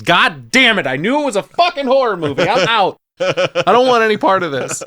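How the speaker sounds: loud, high-pitched acted exasperation; hammed-up, high and loud protest; mock outburst. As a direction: imitate a fed-up moviegoer shouting as she quits. Acts out Kathy's outburst in theater.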